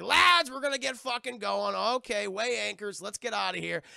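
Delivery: rally the crew to set sail; captain's bellow. Retold or imitated.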